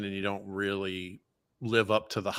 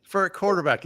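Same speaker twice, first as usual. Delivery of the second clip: normal talking voice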